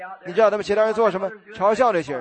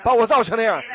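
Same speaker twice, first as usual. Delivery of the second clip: shouting praise; 7 semitones higher than usual. Retold or imitated. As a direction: cry out with joy, jumping. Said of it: "他就大喊起来". (大声欢喊赞美)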